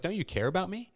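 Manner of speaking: a touch of hammed-up hurt; mock hurt plea. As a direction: play hurt and needy, half-joking. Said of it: "I'm gonna be like".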